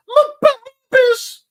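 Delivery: squealed high and loud with a cry; gushing. Real, not acted. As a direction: shriek with love for the babies. Gushing over the pets.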